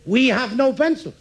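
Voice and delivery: high, falling final example; teacher's emphatic last line; emphatic drill ending. Acted. Last item of grammar drill.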